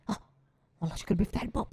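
usual speaking voice

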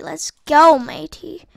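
ordinary talk

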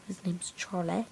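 hushed whisper about his dog. Real, not acted. Kid whispering the dog's name to viewers. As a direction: say the name under your breath. Repeats line, first whispered.

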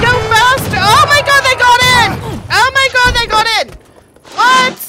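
loud, high alarm; panicked yell. Real, not acted. Player seeing attackers got in, panicked yell. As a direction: yell in alarm as defenses fail.

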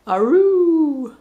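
high, loud wolf howl. Shown as a demonstration. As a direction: throw back your head and howl. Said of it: "What's a wolf say?".

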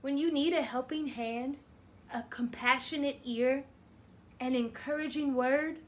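expressive picture-book read-aloud; reading to children. Acted. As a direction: read it warmly to a class. Teacher reads picture book aloud.

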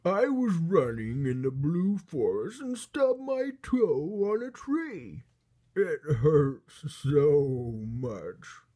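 loud, high, hammed-up blubbering giant; big storybook giant bawling over a sore toe; wailing hurt giant. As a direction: wail it out with long drawn endings. Giant wails over stubbed toe.

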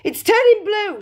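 high, loud cry of horror; scream. A narrator's reading. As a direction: scream like a panicking mother. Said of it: "screamed Missus Beauregard".

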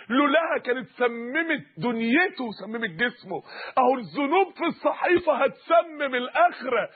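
ordinary delivery

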